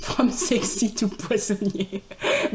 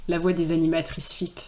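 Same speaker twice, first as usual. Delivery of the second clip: normal talking voice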